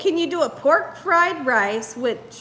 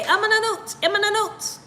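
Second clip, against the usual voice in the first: higher-pitched teacher's refrain in French; imitating French teacher; 5 semitones higher than usual. Recalling the French teacher answering raised hands, lightly imitated. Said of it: "she would say".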